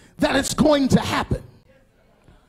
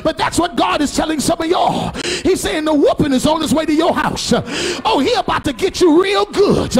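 full-force preaching; 4 semitones higher than usual. Acted. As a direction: whoop it up to the congregation.

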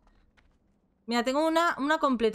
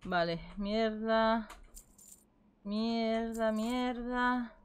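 soft, slow muttered curses; cursing under breath; 6 dB quieter, much slower than usual. Real, not acted. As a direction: grumble quietly to yourself. Muttering while sorting items.

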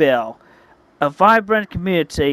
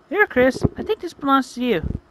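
put-on high-pitched young heroine voice; high character voice; hedgehog girl, friendly; 9 semitones higher than usual. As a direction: voice her high and gentle, handing something back.

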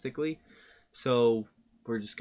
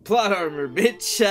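excited; 8 semitones higher, 7 dB louder, slower than usual. Real, not acted. Hyped reaction to video.

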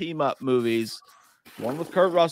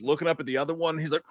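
usual speaking voice

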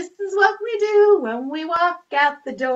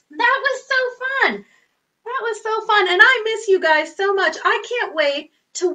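excited; 8 semitones higher, 4 dB louder than usual. Real, not acted. Excited children's host.

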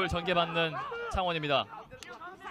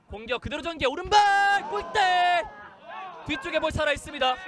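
excited commentary; 8 semitones higher, 4 dB louder than usual. Acted. Chance building in commentary.

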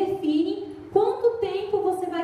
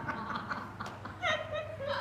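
high burst of laughter; real laugh; 11 semitones higher, 11 dB quieter than usual. Real, not acted. Preacher laughing at her own family story.

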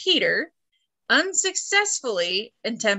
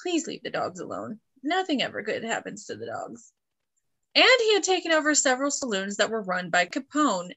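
ordinary delivery

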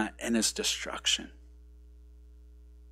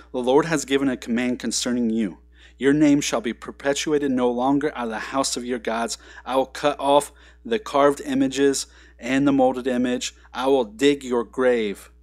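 loud, forceful scripture reading; scripture proclamation; 7 dB louder than usual. Acted. Pastor proclaiming God's judgement on Nineveh, loud. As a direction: thunder the judgement at them. Reads verse fourteen aloud.

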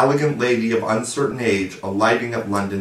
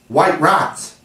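higher, louder eager blurt; inspired cry; 7 semitones higher, 5 dB louder than usual. A narrator's reading. Nibs guessing what the parents had: inspired cry. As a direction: burst out with a bright idea. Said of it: "cried Nibs, inspired".

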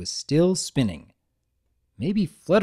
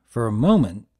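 ordinary delivery

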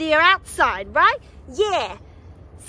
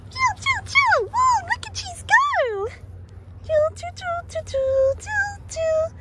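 toy train; 13 semitones higher, slower than usual. Pretend.

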